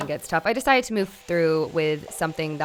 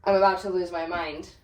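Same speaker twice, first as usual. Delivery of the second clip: ordinary delivery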